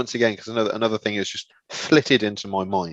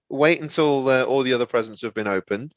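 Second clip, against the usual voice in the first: usual speaking voice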